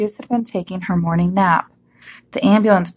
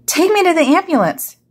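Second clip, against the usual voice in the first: high-pitched urgent call; urgent cry; 9 semitones higher, 3 dB louder than usual. A narrator's reading. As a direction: cry out an order in an emergency. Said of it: "he cried".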